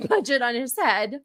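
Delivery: ordinary talk, nothing marked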